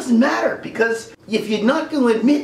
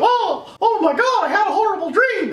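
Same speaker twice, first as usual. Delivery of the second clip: much higher frantic cartoon voice; panicked Mickey. Mickey waking from nightmare, high and frantic. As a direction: wake up gasping in cartoon panic.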